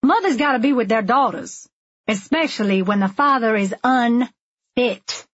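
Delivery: high, indignant retort, last word split by a pause; pointed comeback, slowing to stress the end; snapped back. A narrator's reading. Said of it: "snapped back".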